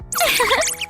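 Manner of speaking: short high laugh; light, delighted little laugh; happy giggle. Acted. Staffer pleased by galette success, happy laugh.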